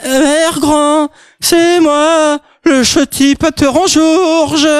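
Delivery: put-on high, slow slurred girl; drunk girl voice. Drunk girl knocking at granny's door, high slow slur.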